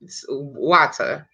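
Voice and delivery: slow "water" with the R sounded at the end; R left in water. Shown as a demonstration. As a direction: drag out "water", R audible. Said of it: "I said the R just then".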